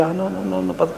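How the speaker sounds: soft, offhand brush-off; mock dismissal. Acting someone who refuses to see, softly dismissive. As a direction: wave it away under your breath.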